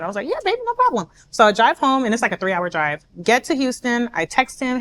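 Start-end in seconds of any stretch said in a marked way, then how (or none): 0.3-1.1 high, singsong reassurance; easygoing girlfriend. Retold or imitated. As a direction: breezily agree in a raised pitch. Breezy reply to boyfriend.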